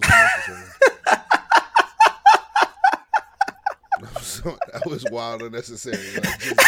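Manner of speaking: high, breaking into laughter; cracking up mid-sentence; laughing hard. Real, not acted. Cracking up at friend's bit.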